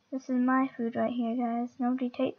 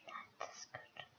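breathy whispered aside; 26 dB quieter, slower than usual. Pretend. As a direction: say it again under your breath. Whispered repeat after loud take.

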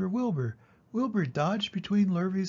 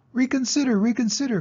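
very high, loud goose cry; a last desperate honk; the goose's last plea to Wilbur, very high and loud; 9 semitones higher, 5 dB louder than usual. A narrator's reading. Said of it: "cried the goose".

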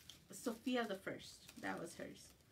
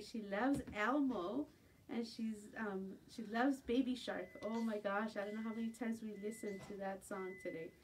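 usual speaking voice

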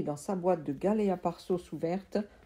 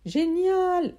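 high, loud joyful yell; 11 semitones higher, 7 dB louder than usual. Acted. Uncle yelling with joy at the tree. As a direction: shout it out in glee. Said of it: "n'arrêtait pas de hurler mon oncle".

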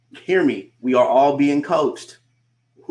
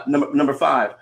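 ordinary talk

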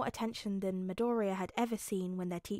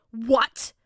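loud, shocked outburst; demanding; 12 dB louder than usual. A narrator's reading. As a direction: demand it in sudden outrage. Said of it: "Bakugo demanded".

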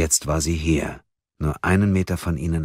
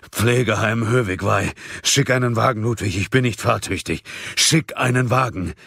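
higher, pressing phone instructions; urgent plea; 6 semitones higher than usual. A narrator's reading. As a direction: press him to send help now. Trembling Munk urgently requests a car. (dringlich, mit erhobener Stimme)

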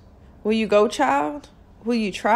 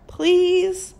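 a high, rising last plea; high pleading please; imploring; 10 semitones higher than usual. A narrator's reading. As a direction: beg with the pitch climbing up. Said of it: "Stella implored".

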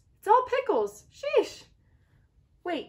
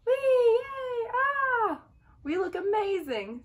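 much higher, giddy party squeals; high gleeful egg chorus shrieking with joy; squealing excited eggs; 13 semitones higher, 5 dB louder, slower than usual. Acted. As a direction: cheer like tiny eggs let loose.

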